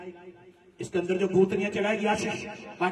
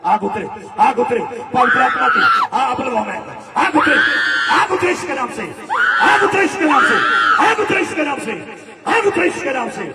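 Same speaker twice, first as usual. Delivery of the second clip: loud, high cries calling fire down; shouted invocation; 3 semitones higher, 7 dB louder than usual. Real, not acted. Preacher shouting fire onto the crowd, fervent. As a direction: shout it like a summons.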